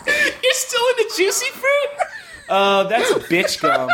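high, slow, sneering mock voice; snooty put-on voice. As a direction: look down your nose, laughing. Put-on snobby gum voice.

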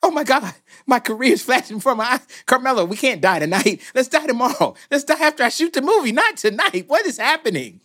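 high, frantic pleading; hammed-up panic. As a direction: beg in mock desperation. Panic at the nightclub.

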